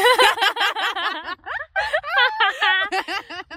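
a loud high peal of laughter that spills out; high burst of laughter. Real, not acted. Laughing hard at her friend's pick-me comment, a loud high laugh.

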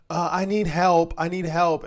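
high, slow whiny plea for help; mock panicky cashier. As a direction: imitate a flustered cashier begging.

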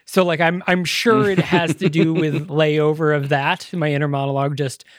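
usual speaking voice

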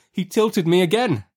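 narration pitched right up, tense; high, escalating narrator's alarm; mounting alarm. A narrator's reading. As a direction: let the narrator's pitch soar as the danger peaks. Tilts escalate to the last.

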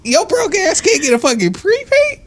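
high, scornful and amazed; incredulous put-down; mocking disbelief. Real, not acted. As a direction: rub in how ridiculous it is. Disbelief at a friend's excuse.

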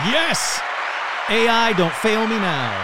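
loud high cheer then slow plea; root for the machine to deliver; excited cheer. Real, not acted. Host cheers the AI on before the scene, loud excited.

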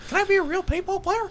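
high squeaky puppet voice, laughing; Pinocchio voice. Pretend. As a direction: ask it like a little wooden boy.

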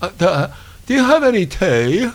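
talking with mouth stuck open; mouth propped open. Pretend. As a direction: speak with jaw hanging open. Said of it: "my mouth is stuck open".

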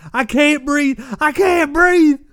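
high, loud desperate cry; desperate shout. Retold or imitated. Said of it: "I listened to him saying".

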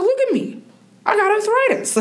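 very high, comic imitation of dad; protesting father. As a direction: mimic dad's incredulous protest, breaking into a laugh. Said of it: "He's like".

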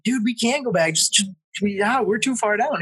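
high, stammering mock protest; retold pleading. Driver refusing to turn back, retold pleading. Acted quarrel, pleading not to return.

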